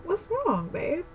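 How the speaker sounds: soft, high, gentle concern; quiet imitation of a caring fiance; fiance's worried question. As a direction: ask softly like a worried partner. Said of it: "And he was like".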